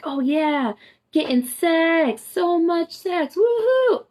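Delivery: high, slow, sing-song mock excitement; mocking glee. Mocking everyone's early quarantine excitement, high and slow. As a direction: ham up everyone's early cheer.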